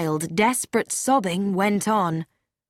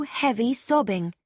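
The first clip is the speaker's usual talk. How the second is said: plain reading voice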